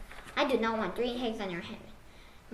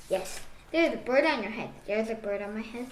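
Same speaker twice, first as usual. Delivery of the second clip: usual speaking voice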